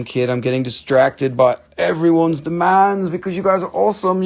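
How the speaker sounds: normal talking voice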